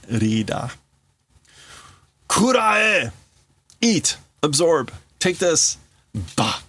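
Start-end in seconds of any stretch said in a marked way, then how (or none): 2.3-3.1 much higher, louder shout; battle shout. Acted. Tetsuo firing at Kaneda, full battle shout. 3.8-5.8 translated battle cry. Acted. Player echoing Tetsuo's attack, half-shouted battle cry.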